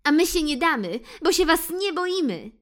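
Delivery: high, loud cheerful defiance; calling out together. Acted. Friends call back to the cats, high loud. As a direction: call back bravely as a group. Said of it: "zgodnie zawołali".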